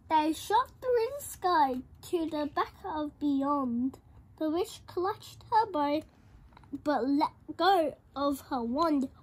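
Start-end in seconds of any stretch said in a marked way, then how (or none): none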